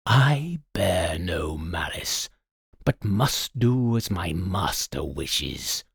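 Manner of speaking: slow, low, resigned spirit; solemn servant djinn in a low voice; deep-voiced djinn. A narrator's reading. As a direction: speak deep and slow, bound to obey. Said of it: "the blue fellow replied in a deep voice".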